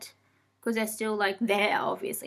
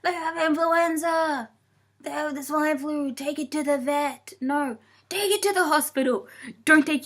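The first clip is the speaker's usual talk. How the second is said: higher-pitched, hammed-up parental panic; mock panic; 5 semitones higher than usual. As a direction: play the over-anxious new parent. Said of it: "I'll be like".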